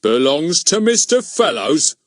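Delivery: high, loud astonished question; amazed repeat. Acted. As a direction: echo it back in disbelief. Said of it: "he repeated".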